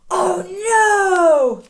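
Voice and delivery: high, loud playful cry of alarm; mock fright. Teacher playing scared of the wolf for the kids, high and loud. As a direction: cry out in pretend panic.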